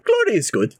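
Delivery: glory said high, then falling away; high savouring. Acted. Drunk artificer toasts the glory, high and savouring. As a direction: lift GLORY up high and let it drop.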